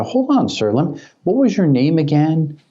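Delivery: high, sweetly polite stall; feigned politeness to keep the scammer talking; mock polite stalling.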